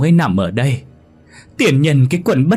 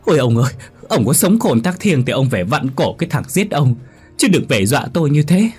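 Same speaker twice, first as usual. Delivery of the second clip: terrified pleading; 6 semitones higher, 4 dB louder than usual. Acted. Wife begs supposed ghost, kneeling.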